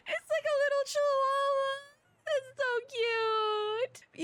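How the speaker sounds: high, tearful squeal, stretching the words out; gushing squeal. Real, not acted. Streamer squealing to chat over the baby dog, tearful and high. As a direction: melt over a tiny puppy.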